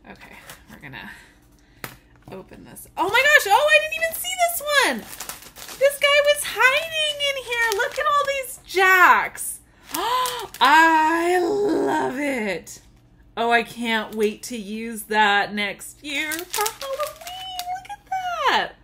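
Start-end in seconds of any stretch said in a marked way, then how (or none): 3.0-9.6 high, loud, excited squeal; thrilled discovery. Real, not acted. Spotting a hidden item in the box, thrilled. Finds a hidden extra gift. 9.8-12.8 loud, very drawn-out gush; emphatic delight. Real, not acted. Gift opener after a gasp at her find, emphatic delight. 16.8-18.7 very high, delighted squeak; excited squeal. Real, not acted. Showing viewers a new gift, excited squeal.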